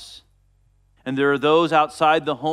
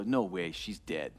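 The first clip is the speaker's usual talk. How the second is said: lower, scoffing dismissal; mocking; 9 semitones lower, 9 dB quieter than usual. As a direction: scoff at the idea that she's only sleeping. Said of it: "they laugh with mocking".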